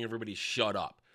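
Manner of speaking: ordinary delivery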